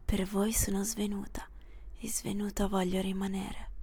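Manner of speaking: soft whispered defiance. Acted. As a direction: whisper it to yourself, stubborn.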